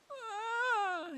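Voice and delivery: high, loud, choked cry; a sharp cry of anguish. Acted. Dan breaks off in grief, anguished cry.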